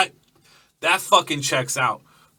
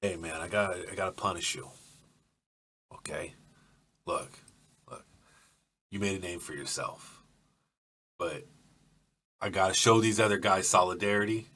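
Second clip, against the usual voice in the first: lower, much softer explaining; low quiet Gus; 5 semitones lower, 8 dB quieter than usual. Acted. Gus quietly explaining the punishment, low and soft. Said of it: "Gus, what the fuck?".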